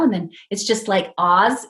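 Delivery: ordinary talk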